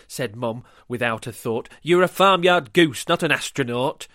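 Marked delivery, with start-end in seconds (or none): 1.8-4.1 loud stern mum voice; stern mother. Acted. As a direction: scold like a firm mother. Mum scolds Goose.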